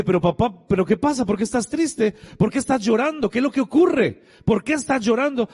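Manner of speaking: high, insistent, retold worry; worried questioning. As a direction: press a crying father with anxious questions. Son questioning his weeping father.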